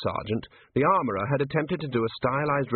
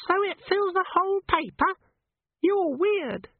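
squeaky imp. A narrator's reading. Imp character voice.

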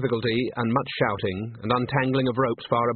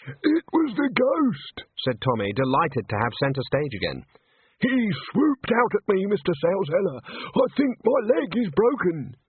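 high dramatic stagehand voice; 8 semitones higher than usual. Acted. Tommy milking centre stage.

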